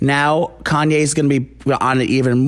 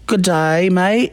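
mock Aussie greeting, pitched up; imitated Australian accent; 6 semitones higher, much slower than usual. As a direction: do a jokey Australian accent, higher than usual. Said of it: "talking with an Australian accent now".

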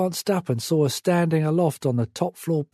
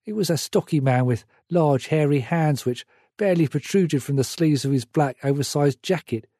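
plain reading voice

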